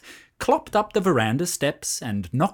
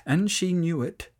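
plain reading voice

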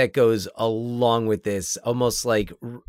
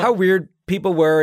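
higher and louder, delighting in the phrase; emphatic echo; 6 semitones higher, 6 dB louder than usual. Real, not acted. Co-host echoing her friend's line with excited agreement. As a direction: toss the words back with gusto.

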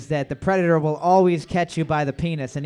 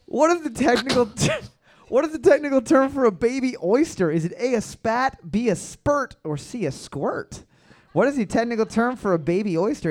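ordinary talk, nothing marked

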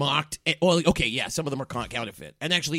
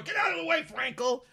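shouted order. Acted. Manager shoves past celebrity at door.

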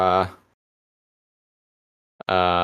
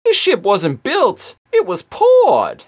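very high, loud cartoon voice; exaggerated high-pitched exclamation, stretching the last word; Rat Trap; 6 dB louder, slower than usual. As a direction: squawk it slowly in a high character voice. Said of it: "and Rat Trap is like".

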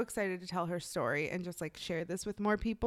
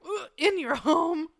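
mock disgust; 8 semitones higher, 4 dB louder than usual. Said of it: "I was like".

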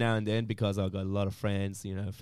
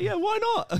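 eager yes; 3 dB louder than usual. Retold or imitated. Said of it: "I said".

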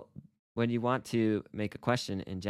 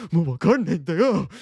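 high, loud, hammed-up panic; mock identity crisis; 8 semitones higher, 11 dB louder than usual. Teacher acting out total confusion over who he is, mock panic. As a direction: wail it in comic despair. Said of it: "having this identity crisis where I'm like".

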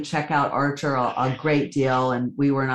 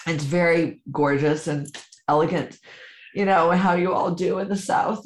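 usual speaking voice

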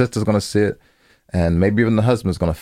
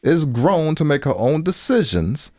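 higher, pushing argument voice; heated insistence; 11 semitones higher than usual. Real, not acted. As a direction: argue the point hard.

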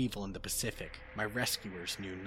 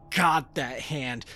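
loud, slow, horrified cry; terrified scream; 5 semitones higher, 11 dB louder, slower than usual. A narrator's reading. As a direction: scream at the hand in horror. Sees the hand, terror.

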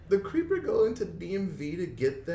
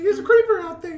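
put-on little kid voice; 10 dB louder than usual. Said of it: "He's like".

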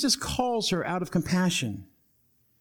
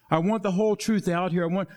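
ordinary talk

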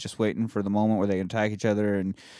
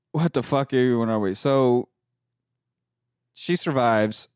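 ordinary talk, nothing marked

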